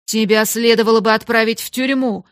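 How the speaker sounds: loud, threatening outburst; outraged headmistress; angry shout. A narrator's reading. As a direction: shout it as a harsh reprimand. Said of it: "крикнула мисс Минчин".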